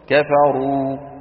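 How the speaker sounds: كفروا recited slowly with a full, heavy ra; heavy ra recitation. Shown as a demonstration. Teacher reciting an example to students, heavy ra. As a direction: draw out كفروا with a thick ra. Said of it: "إذا كانت الراء مضمومة".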